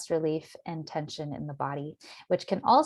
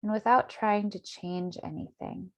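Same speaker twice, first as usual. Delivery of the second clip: calm, measured meditation voice with long pauses; guided meditation; 3 dB quieter than usual. Acted. Meditation teacher settling listeners into their bodies, guided meditation. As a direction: guide them gently inward, unhurried.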